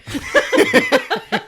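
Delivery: loud high cackling laugh; a shrieking laugh; burst of laughter. Real, not acted. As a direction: break into helpless laughter. Can't read own handwriting.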